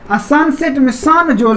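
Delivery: ordinary delivery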